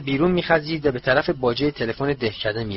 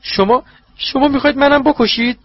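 much higher, louder, panicked stammer; in terror; 12 semitones higher, 5 dB louder than usual. Acted. Wormtail horrified, asking if his master will kill him. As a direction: shriek the question in horror. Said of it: "با وحشت".

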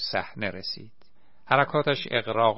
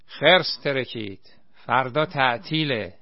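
loud mocking call from the crowd; heckling shout; 3 semitones higher, 7 dB louder than usual. A narrator's reading. A heckler in the crowd mocking the actor, loud jeering shout. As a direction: shout a joke from the audience. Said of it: "یکی از آن وسط گفت".

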